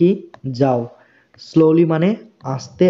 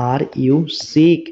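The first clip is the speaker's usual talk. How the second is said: very slow, words drawn apart; slow model reading; 2 semitones higher, much slower than usual. Shown as a demonstration. Teacher repeating the question slowly for students. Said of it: "teacher models English phrase".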